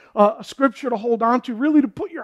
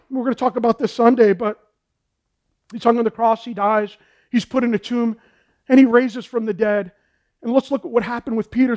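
ordinary talk

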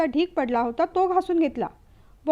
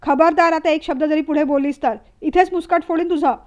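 threatening shout; 6 dB louder than usual. Acted. Husband raises hand threatening.

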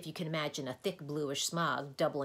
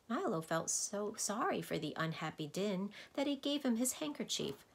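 ordinary delivery

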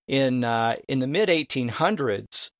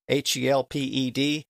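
normal talking voice

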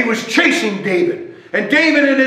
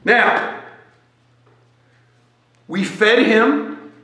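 ordinary talk

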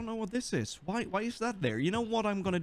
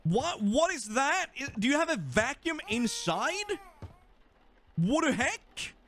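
high-pitched, incredulous questioning; baffled surprise; 7 semitones higher than usual. Real, not acted. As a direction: ask in real bafflement, voice jumping up. Baffled by strange sight in game.

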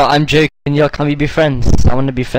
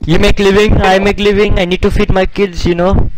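higher, insistent put-on seller voice; pleading scammer shopkeeper; 5 semitones higher, 3 dB louder than usual. Shopkeeper defends his price to customer, pleading scammer voice. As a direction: plead your case as the mock scammer. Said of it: "the Microsoft Scammer Dave".

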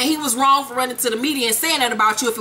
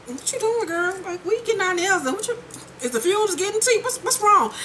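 higher, mock-concerned girlfriend voice; higher mock-concerned friend at the nail salon; mocking Tierra voice; 8 semitones higher, 3 dB quieter than usual. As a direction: fake concern, high and airy. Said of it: "and Tierra's like".

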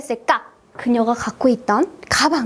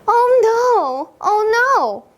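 high, dramatic dismay; acted dismay; 8 semitones higher, much slower than usual. Child in a dialogue who dropped mom's flower pot, acted dismay. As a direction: play up the alarm, pitched well up.

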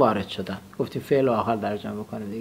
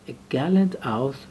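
slow reading; much slower than usual. Shown as a demonstration. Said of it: "teacher reads phrase slowly".

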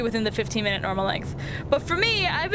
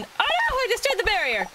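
high squeak of dismay; dismayed cry breaking upward; voice cracking; 8 semitones higher than usual. Real, not acted. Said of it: "My voice just cracked like a prepubescent boy".

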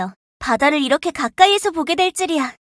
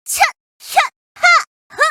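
sharp grunts of effort with each swing; short fighting exertion huffs; 12 semitones higher, 3 dB louder than usual. Acted. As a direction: grunt hard as you strike. Combat effort while fighting.

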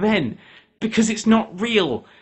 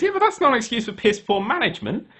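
normal talking voice

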